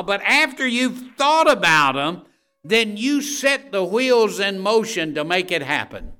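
loud, high, rising sermon exhortation; full-force preaching. Acted. Preacher urging the congregation to act, at full force. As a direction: drive the verse home to the congregation.

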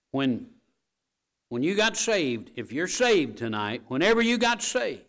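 high, loud preacher's cry; rising preacher's shout; preaching loud. Acted. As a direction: lift it up and shout it. Preacher building to a climax.

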